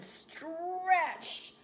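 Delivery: STRETCHED pulled out very long; drawn-out word. A narrator's reading. Fat cat getting up, drawn-out "stretched".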